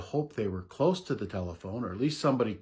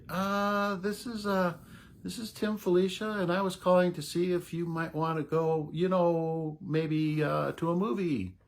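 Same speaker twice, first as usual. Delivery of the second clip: high, hesitant, larynx pushed up; nervous high voice; 7 semitones higher than usual. Retold or imitated. Boy asking a girl to the movies, nervously high-pitched. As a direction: stammer out the date offer, pitched high. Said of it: "Hear that larynx go up?".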